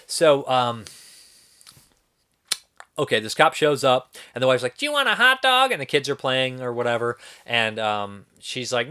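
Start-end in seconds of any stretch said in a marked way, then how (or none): 4.8-5.7 high, loud put-on wife voice; mock chirpy wife. Wife offering the visiting cop food, mock high-pitched call.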